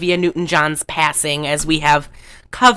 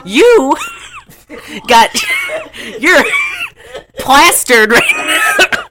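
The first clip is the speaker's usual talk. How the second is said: high, halting words between bursts of laughing; loud, broken up by real laughter; helpless laughter; 3 dB louder, slower than usual. Real, not acted. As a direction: try to accuse while cracking up. Cracking up at drunk friend.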